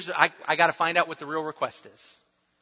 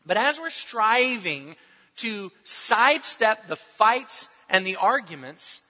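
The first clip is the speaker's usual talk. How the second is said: ordinary delivery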